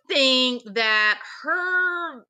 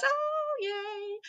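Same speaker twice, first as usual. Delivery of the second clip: much higher, squealing mock girl voice; excited little girl; 11 semitones higher than usual. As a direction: gush like a starstruck little girl, high and shrill with tears. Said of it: "how excited the little girl was".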